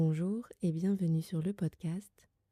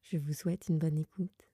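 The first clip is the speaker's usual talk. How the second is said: ordinary talk, nothing marked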